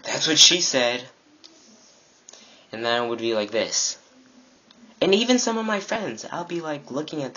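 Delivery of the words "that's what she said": mocking pervert. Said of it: "some pervert would be like".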